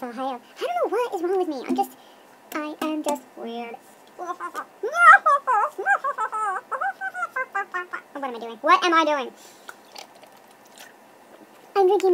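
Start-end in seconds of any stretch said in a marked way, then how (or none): 4.2-8.1 high, silly string of laughing hoots; goofy mock laugh. Vlogger goofing at the camera, silly high laugh. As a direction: hoot out a long, silly cackle. Goofing after calling herself weird.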